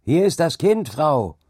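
high, loud call to the woman; shouting. A narrator's reading. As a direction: shout it urgently across to her. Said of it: "schrie John ihr zu".

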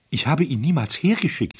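high, loud, upset hotelier; agitated protest. A narrator's reading. Hotelier defending himself to the club, agitated. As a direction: protest it in alarm.

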